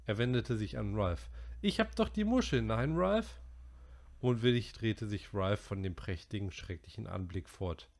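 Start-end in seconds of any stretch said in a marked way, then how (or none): 1.6-3.4 put-on high, worried boy's voice; Piggy's voice. A narrator's reading. Narrator voicing Piggy to the boys, high and worried. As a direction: voice the anxious fat boy.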